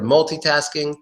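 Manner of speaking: "multitasking" as mul-TEE-tasking; MUL-TEE variant. Shown as a demonstration. Teacher lists three ways, multitasking with TEE. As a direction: say "multitasking" with a clear "ee" after the T. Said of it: "three ways to say that word".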